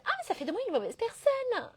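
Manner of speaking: high, swooping mock dismay; mock self-reproach. Entrepreneur quoting her old guilt, mock horrified. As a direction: act out an exaggerated inner panic. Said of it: "Au début, j'étais en mode".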